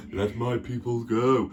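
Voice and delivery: slow, solemn voice of God. Acted. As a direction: deliver a slow, drawn-out divine command. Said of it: "and God's like".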